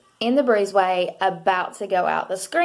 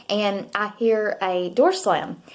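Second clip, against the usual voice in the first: ordinary talk, nothing marked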